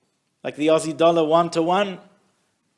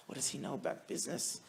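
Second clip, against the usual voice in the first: a sigh, then a voiceless doubting whisper; 2 semitones lower, 17 dB quieter, faster than usual. Acted. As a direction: mutter it to himself in disbelief. Partner's private doubt after refusal.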